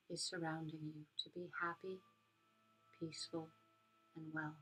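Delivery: soft spa whisper. Acted. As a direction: guide them gently into calm. Leads a metta meditation.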